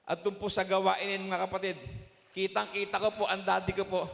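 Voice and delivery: rising to a loud, fervent testimony climax; preaching fervor. Acted. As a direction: lift your voice to the church in praise.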